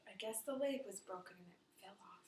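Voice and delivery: fast whispered explanation; whispered aside. Real, not acted. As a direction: whisper it quickly. Aside to child.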